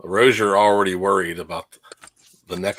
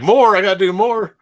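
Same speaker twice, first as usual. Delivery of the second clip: voicing the player; 12 semitones higher, 5 dB louder than usual. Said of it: "He's like".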